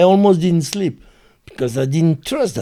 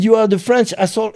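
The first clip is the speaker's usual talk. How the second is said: quick quoted taunt; 8 semitones higher, 5 dB louder, faster than usual. Retold or imitated. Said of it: "in French".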